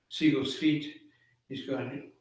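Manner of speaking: plain reading voice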